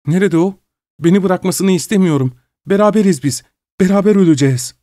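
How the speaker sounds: high, panicked pleading; cling to him in terror; frantic wife. A narrator's reading. Wife panics, husband gone. (korku içinde yalvaran kadın)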